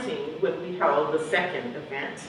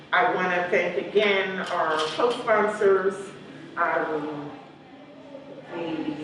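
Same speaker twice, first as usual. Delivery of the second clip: ordinary talk, nothing marked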